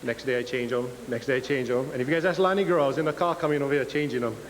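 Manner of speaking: ordinary delivery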